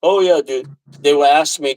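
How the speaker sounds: normal talking voice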